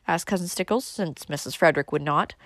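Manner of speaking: usual speaking voice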